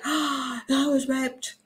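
a hint of a small high victim voice; understated high meek voice; lightly voiced Angela. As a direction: play her lightly, a little high and meek. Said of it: "And she's like".

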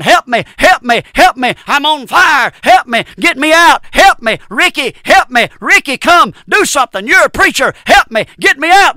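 high, loud desperate screaming to the preacher; pleading scream. Acted. Lost relative screams to preacher Ricky from hell. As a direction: beg Ricky to save you from the fire. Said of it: "they're gonna be saying".

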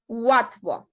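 "Łatwo" with Ł said as English w, "watwo"; "Łatwo": start with a w sound, not an l; Polish ł like w. Shown as a demonstration. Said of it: "To jest taka literka W". ("Łatwo" з Ł як англійське w)

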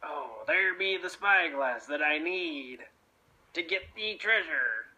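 low scheming pirate; low, greedy pirate aside; sneaky pirate voice. Acted. Blackbeard eyes the spyglass.

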